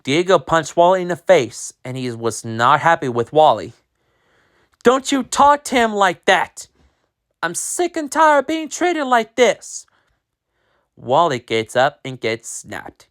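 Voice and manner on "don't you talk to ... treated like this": high, strained, near-shouting; fed-up outburst. Acted. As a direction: finally snap back at the bully. Diego fed up.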